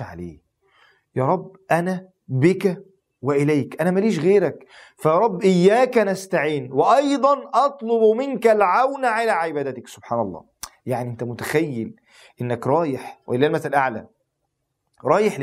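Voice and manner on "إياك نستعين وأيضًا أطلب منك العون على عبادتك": higher, slowed pleading; rising fervent plea. Acted. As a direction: stretch the plea upward.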